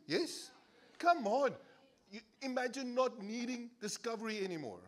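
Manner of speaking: normal talking voice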